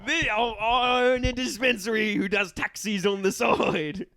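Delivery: high Cockney through laughter; hammed-up London cabbie cracking up; Cockney taxi driver. Cabbie confessing his side business, high laughing Cockney. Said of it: "Cockney taxi captain".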